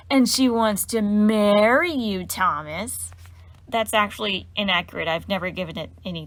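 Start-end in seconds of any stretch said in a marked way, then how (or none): 0.1-3.1 slow, loud drawn-out teasing chant; mocking sing-song. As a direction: drag it out to humiliate her.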